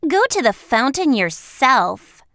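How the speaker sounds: loud, haughty order; rude. A narrator's reading. Haughty girl dismissing the lady, loud and rude. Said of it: "she rudely replied".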